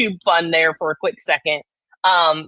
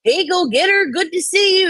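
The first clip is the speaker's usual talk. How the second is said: higher-pitched mock chirpy roll-call of hellos; overfriendly greeter; 4 semitones higher than usual. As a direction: parody someone greeting everyone by name. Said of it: "be that person and be like".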